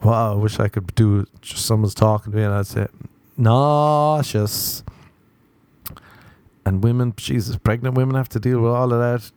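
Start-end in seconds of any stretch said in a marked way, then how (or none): 3.4-4.8 "Nauseous" with a very long stretched first vowel; drawn-out complaint. Acted. Interrupting whoever is trying to help, "Nauseous" stretched long. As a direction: draw out "Nauseous" slowly, NAAAU-shus.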